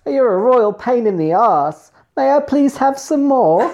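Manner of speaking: high, slow hammed-up Cockney accent; laughing through a silly Cockney urchin voice; mock Oliver Twist accent. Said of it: "Sound like that Oliver Twist".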